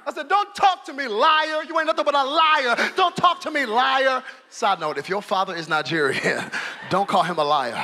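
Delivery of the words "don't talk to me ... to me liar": put-on angry child. Child yelling at father, retold.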